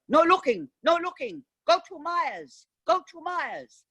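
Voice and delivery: very high, insistent sing-song refusal; shooing shopkeeper Max. Retold or imitated. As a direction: shoo the customer away in a shrill old-man voice. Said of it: "He'd go".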